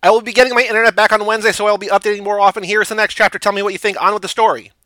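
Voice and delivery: high, loud, rushed note-to-readers voice; gushing author's note. Acted. Fan-fiction author announcing the next chapter, high and rushed. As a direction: race through it high and loud.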